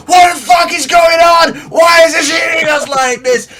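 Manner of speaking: high, full-voiced shout over the storm; panicked bosun screaming. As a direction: yell in alarm across a deck. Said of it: "like, screaming".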